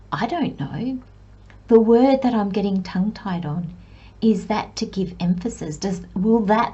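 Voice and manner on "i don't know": ordinary delivery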